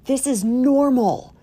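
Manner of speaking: high, loud, drawn-out insistence; mock protest. Mom answering her worried kids, hammed-up protest. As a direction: insist on it, stretching the words.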